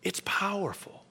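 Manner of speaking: breathless whisper of awe. Real, not acted. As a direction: let it fall to a hush. Quiet awe after the story.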